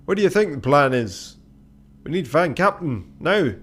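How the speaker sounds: impatient crewman. Acted. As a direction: press the point with loud urgency.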